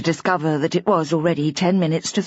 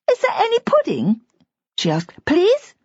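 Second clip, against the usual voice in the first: put-on much higher, pleading child voice; a small girl angling for pudding; eager little girl; 12 semitones higher than usual. A narrator's reading. Little girl asking the grown-ups for pudding.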